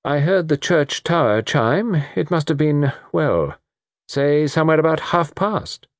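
high-pitched, halting reckoning; unsure, stop-and-start recollection; hesitant. A narrator's reading. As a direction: feel your way toward a time. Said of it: "Delengua hesitated".

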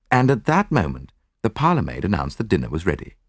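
usual speaking voice

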